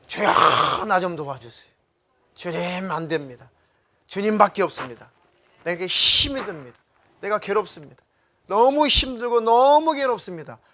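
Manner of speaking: loud, anguished cry to God; pouring out the heart. Acted. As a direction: build from a rasping cry to a high, loud plea. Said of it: "라고 마음을 솔직하게 하나님 앞에 토해내려잖아요".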